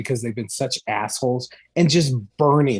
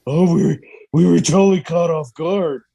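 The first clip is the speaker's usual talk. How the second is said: mocking politicians; 5 dB louder than usual. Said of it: "have the fucking balls to say".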